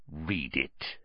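low, very soft menacing hiss; hushed, sinister command; evil hiss. A narrator's reading. Uncle brandishing a letter at Harry, evil hiss. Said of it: "he hissed evilly".

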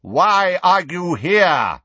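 very slow, loud bellow. Acted. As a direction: bellow the question.

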